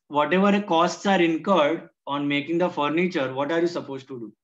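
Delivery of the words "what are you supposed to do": ordinary delivery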